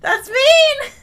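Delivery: high, loud, tearful whine; shaken, wailing complaint; whining protest. Real, not acted. Protests the jump scare.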